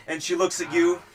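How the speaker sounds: ordinary talk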